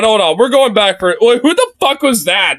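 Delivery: ordinary talk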